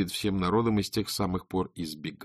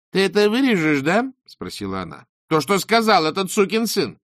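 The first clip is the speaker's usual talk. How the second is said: high, loud furious insistence; angry demand; 10 semitones higher, 5 dB louder than usual. Acted. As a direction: order him to cut it. Beril demanding cut.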